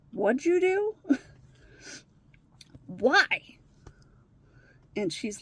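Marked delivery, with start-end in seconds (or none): 0.1-3.6 higher, loud imitation of a teen's protest; retold indignant demand. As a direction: act out an incredulous, upset challenge. Acted teenager confronting his mother.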